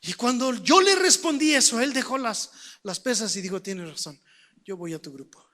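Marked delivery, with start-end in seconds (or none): 3.6-5.5 low, very soft admission; subdued concession. Retold or imitated. Prisoner giving in to the young preacher, hushed and low. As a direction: give in quietly, voice dropped right down.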